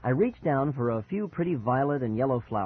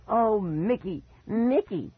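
high-pitched put-on wail; high mocking shriek; 9 semitones higher, slower than usual. Brother mimics his frightened sibling, shrieking high. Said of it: "he shrieked in a high voice".

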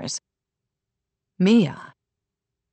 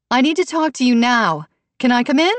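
high, urgent demand through a door; insistent mother; 7 semitones higher, 4 dB louder than usual. A narrator's reading. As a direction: press to be let in.

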